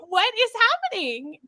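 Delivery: high, bewildered question; baffled exclamation. Retold or imitated. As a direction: ask it in disbelief. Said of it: "I was like".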